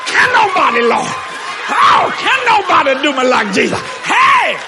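loud, high sung-out preaching; whooping sermon climax. Acted. Preacher whooping to the congregation, loud and high.

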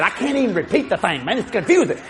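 ordinary talk

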